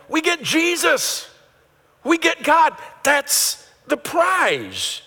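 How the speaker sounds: high, loud, slow proclamation; full-force preaching. Acted. Pastor proclaiming God as the prize to the church, loud and high. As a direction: lift the roof with it, drawn out.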